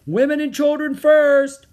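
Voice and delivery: high, loud call across the deck; shouted command. A narrator's reading. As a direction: shout it as an evacuation order. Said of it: "someone shouted".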